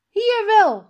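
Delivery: high, loud insistence; boy firmly contradicting the teacher; defiant retort. A narrator's reading. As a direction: snap it back stubbornly.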